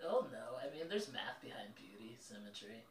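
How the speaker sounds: hushed nerdy confession; shy kid whisper. Acted. GM voices shy classmate Paul.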